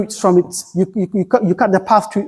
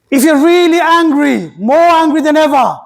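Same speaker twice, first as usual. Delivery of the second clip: higher, stronger preacher push; emphatic preaching; 8 semitones higher, 3 dB louder than usual. Acted. Preacher stressing real anger to his congregation, emphatic. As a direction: lift it up to drive the point home.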